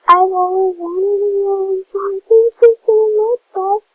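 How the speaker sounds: high, loud, slowed cry of joy; overjoyed thanks. Real, not acted. Streamer thanking viewers for a wished-for item, loud joyful gush. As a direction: gush thanks to the whole chat.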